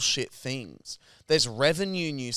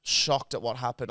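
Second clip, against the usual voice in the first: usual speaking voice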